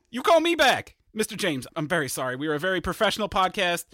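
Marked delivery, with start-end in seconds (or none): 0.1-0.9 louder, snapping back down the phone; calling back. Acted. Producer shouts back at his caller, calling out.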